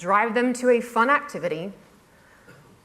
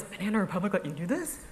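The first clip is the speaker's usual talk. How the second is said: softer disbelieving question, rising at the end; incredulous; 7 dB quieter, faster than usual. Retold or imitated. Blogger imitating readers who doubt the sponsor allowed it, incredulous. Quoted reaction turns to doubt.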